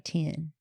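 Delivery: "ten" drawled like "tin"; default Southern "ten". Shown as a demonstration. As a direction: let "ten" slide into "tin". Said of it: "my default's".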